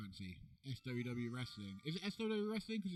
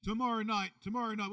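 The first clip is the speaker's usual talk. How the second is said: loud, higher promo call; announcer hype; 6 semitones higher, 8 dB louder than usual. Pretend. Two hosts hyping the wrestling show to camera, booming promo call.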